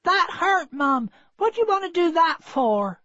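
put-on high, hurt young dog voice; a wounded pup asking his mother why; high young puppy voice, aggrieved; puppy's voice.

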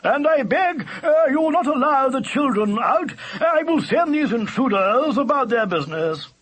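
loud, high-pitched, courtly proclamation; cultured old-fashioned badger. A narrator's reading. Badger ordering his family to stay inside, grand. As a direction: declaim like an old gentleman badger. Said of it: "tones were deep and cultured".

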